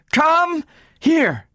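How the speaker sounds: very high, drawn-out command; high-pitched desperate summons; frantic cry. A narrator's reading. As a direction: cry it out, stretching each word. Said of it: "she cried".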